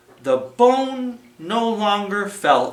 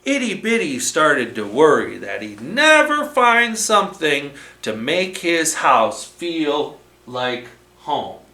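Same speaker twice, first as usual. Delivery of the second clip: plain reading voice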